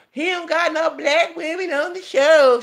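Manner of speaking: high, loud mocking imitation; Wendy Williams. Mimicking a talk host's complaint about the show, mocking. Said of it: "And remember, Wendy Williams".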